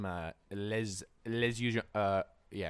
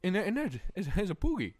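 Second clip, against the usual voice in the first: much higher, mumbled voice; homeless man; 11 semitones higher, 3 dB louder than usual. Retold or imitated. Homeless guy outside the store pointing, high mumble. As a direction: squeak it out like a slurring old man. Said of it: "he's like".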